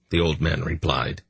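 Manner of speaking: ordinary delivery, nothing marked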